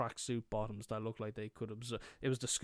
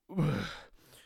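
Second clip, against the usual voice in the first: short loud shudder of disgust; horrified noise; 4 semitones higher, 6 dB louder than usual. Retold or imitated. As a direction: blurt a startled, wordless noise. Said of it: "I made a noise".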